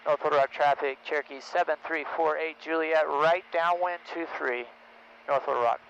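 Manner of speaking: loud, clipped pilot radio call. Real, not acted. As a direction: announce position over the radio.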